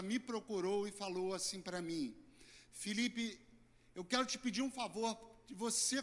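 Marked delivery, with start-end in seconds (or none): none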